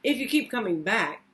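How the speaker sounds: ordinary delivery, nothing marked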